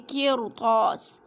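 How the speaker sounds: قرطاس said very slowly with a thick, heavy ra. Shown as a demonstration. Teacher models قرطاس for students, slow with heavy ra. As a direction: stretch قرطاس out and make the ra full and heavy. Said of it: "موٹا ہی پڑھیں گے".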